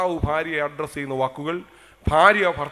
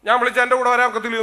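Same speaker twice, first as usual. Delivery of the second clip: higher, louder, fast mock scolding; gruff old-style husband; 7 semitones higher, 7 dB louder, faster than usual. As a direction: bark at her like an old-time husband. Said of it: "ഉടനെ പറഞ്ഞു". (ഭർത്താവ് ഭാര്യയോട് കയർത്ത്, ഉറക്കെ വേഗത്തിൽ)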